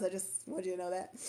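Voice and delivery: normal talking voice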